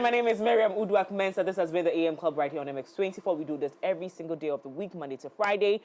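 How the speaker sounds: ordinary delivery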